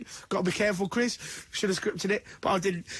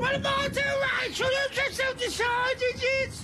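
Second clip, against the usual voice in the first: mock outraged shout. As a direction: yell it out in jokey disbelief.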